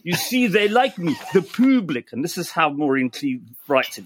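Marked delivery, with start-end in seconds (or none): 0.0-2.1 put-on lightly French-accented reading, a touch higher; quoting a French singer in a hint of a French accent; gentle mock French accent. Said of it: "that you did that in French".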